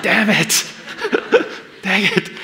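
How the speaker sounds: a loud, frustrated yell broken by a laugh; frustrated outburst. Acted. Peter gets one chip and yells in frustration, laughing. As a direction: belt it out like a disappointed fisherman cursing his luck. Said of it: "So he's like".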